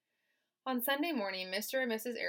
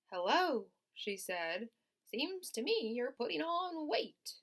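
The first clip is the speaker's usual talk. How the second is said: put-on high, raised old sheep voice; 6 semitones higher than usual. Reading to the class as the old sheep, higher.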